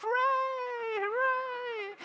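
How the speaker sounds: squealed, very high mock hooray; cheering studio audience. Audience applauds the guest's cookery show, mock cheering. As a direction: parody a mindlessly delighted crowd. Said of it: "Everybody goes".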